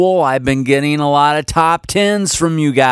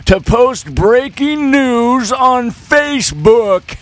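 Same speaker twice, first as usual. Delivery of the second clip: put-on old man voice; 6 semitones higher, slower than usual. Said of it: "I will read these with my old man voice".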